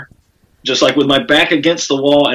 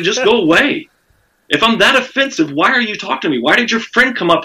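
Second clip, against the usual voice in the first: high-pitched pleading protest, retold; retold exasperation; 7 semitones higher than usual. Comedian to woman who followed him, retold exasperation. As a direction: re-enact being fed up, pitched well up.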